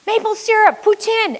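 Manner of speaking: high put-on crowd voice; crowd calling out. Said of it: "everybody says".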